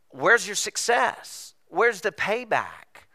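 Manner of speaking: high, slow, drawn-out questions; hammed-up high-pitched complaint; mock exasperated demand. Mock complaint about measuring parenting results.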